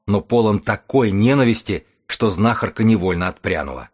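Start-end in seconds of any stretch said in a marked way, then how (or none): none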